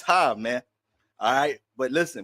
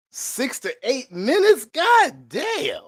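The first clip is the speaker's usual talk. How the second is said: shocked; 11 semitones higher, 4 dB louder, much slower than usual. Real, not acted. Shocked at a long freeze.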